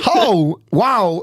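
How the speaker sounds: high exclaimed impression of his cry; Christopher Walken. Showing how Walken cries out, Walken impression. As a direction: burst out high in character. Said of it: "Have you never heard him do that?".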